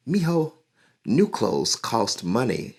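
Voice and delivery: a hint of a gentle mom; patient mother explaining; mother's reply. Mother telling her son clothes cost money, lightly voiced. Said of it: "his mother said".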